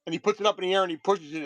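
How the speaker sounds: ordinary talk, nothing marked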